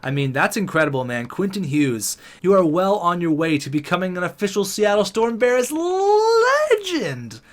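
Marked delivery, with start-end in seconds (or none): 2.4-7.4 drawn-out legend call. Pretend. Gamer crowning Quinton Hughes, big drawn-out hype. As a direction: build to a huge, long-held high "legend". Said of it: "That could have been the biggest legend yet".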